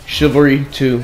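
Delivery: loud upbeat channel intro; YouTuber intro. Acted. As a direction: hype up the viewers at the start.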